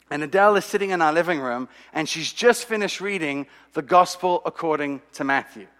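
normal talking voice

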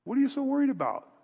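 a son gently chiding his worried parents; gentle rebuke. Shown as a demonstration. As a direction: brush off their worry gently. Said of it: "a gentle rebuke to his parents?".

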